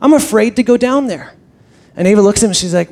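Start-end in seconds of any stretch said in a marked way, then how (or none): 0.0-1.3 put-on scared little boy. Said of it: "he said".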